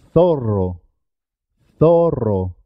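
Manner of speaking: zorro with a trilled rr; marked double R. Shown as a demonstration. Teacher models zorro for learners, double R trilled. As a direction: roll the R in zorro. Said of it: "Es importante marcar".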